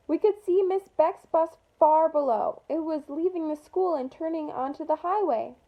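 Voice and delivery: usual speaking voice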